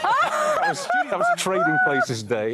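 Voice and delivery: high squealing laughter through the words; laughing so hard the words squeak out. Real, not acted. Host laughing at his old TV clip.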